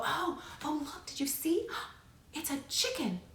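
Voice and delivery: soft, high gasping wonder; showing a puzzle piece to a child. Shown as a demonstration. Parent to toddler over a puzzle, hushed delighted wonder. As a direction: lean in and gasp softly over a chicken. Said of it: "you're gonna go".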